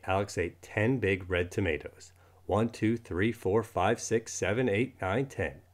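ordinary delivery, nothing marked

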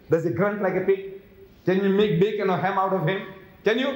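usual speaking voice

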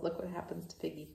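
ordinary delivery

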